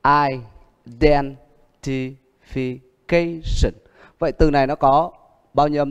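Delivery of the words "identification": "Identification" stretched out very slowly, with "ca" stressed; very slow stress demo. Shown as a demonstration. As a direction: drag out every syllable of "Identification". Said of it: "các bạn rõ âm". (đọc thật chậm "Identification", nhấn "ca")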